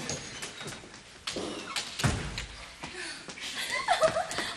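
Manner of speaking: high, breathy cackle of a woman pleased with herself; gleeful cackle. Acted. Woman gloating to her lover after a murder, cackling. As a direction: laugh like you just got away with it.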